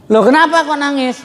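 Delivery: suddenly puzzled by the crying; surprised question. Retold or imitated. Buyer surprised, seller crying. (kaget bertanya)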